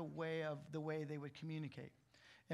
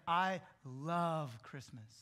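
"love" drawn out slowly and leaned on; stressed love; 3 dB louder, slower than usual. Shown as a demonstration. Pastor shows the congregation how we stress love: slow, leaning on "love". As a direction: linger on "love" to show how much you mean it. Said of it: "we tend to emphasize that word".

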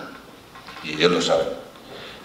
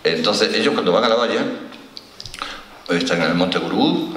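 usual speaking voice

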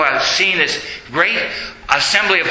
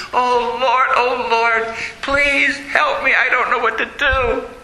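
desperate prayer; 7 semitones higher than usual. Acted. Brother Angelo stranded on the bridge begging God, higher desperate plea. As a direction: cry out to God for help.

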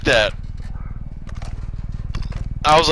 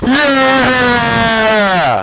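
victory shout; 6 semitones higher, much slower than usual. Real, not acted. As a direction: cheer it out, triumphant. Celebrating the win.

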